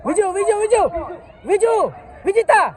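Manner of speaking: high calling shout. Real, not acted. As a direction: call him over from afar. Calling a player across the pitch.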